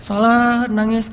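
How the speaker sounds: normal talking voice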